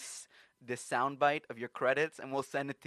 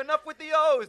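loud high mock boss shout; hammed-up mafia boss yelling; 14 dB louder than usual. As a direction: shut the cheering down like a mob boss. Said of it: "and then the head mafia guy's".

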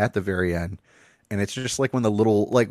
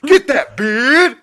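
high, loud, stretched-out hype shout; hype man; 8 dB louder, much slower than usual. Acted. Hyping the teacher's puberty lesson, hype man shout. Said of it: "hype man for like, a, a sex talk".

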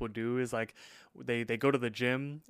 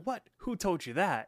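higher-pitched startled question; indignant surprise; 7 semitones higher than usual. Retold or imitated. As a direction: imitate someone caught out and taken aback. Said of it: "Rigby's all like".

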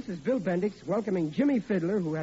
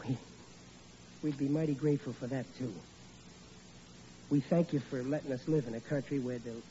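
hushed, halting grace said softly; humble prayer; 7 semitones lower, 3 dB quieter than usual. Acted. Father saying Thanksgiving grace for the family, hushed and humble. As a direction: pray simply and sincerely, voice dropping low.

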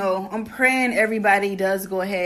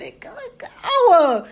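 laughing; 10 semitones higher, 5 dB louder, slower than usual. Real, not acted. Laughs at show ending.

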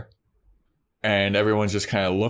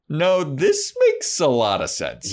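laughing Oz; 6 semitones higher, 3 dB louder than usual. Retold or imitated. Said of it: "Oz goes".

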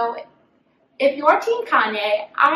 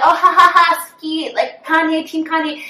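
high, loud fake cackle; mocking laughter; 5 semitones higher, 5 dB louder than usual. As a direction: laugh it off like a fan. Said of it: "people are like".

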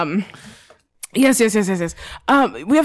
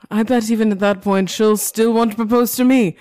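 normal talking voice

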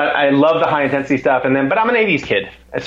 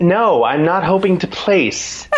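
slow, higher, emphatic denial; mock indignation; 5 semitones higher, slower than usual. Amateur setting the German pro straight, mock indignation. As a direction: spell it out with comic exasperation.

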